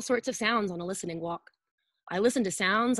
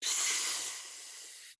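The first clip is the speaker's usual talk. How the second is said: whispered "Psst" hiss; bus brakes hiss; 9 dB quieter than usual. Shown as a demonstration. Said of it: "The bus stops".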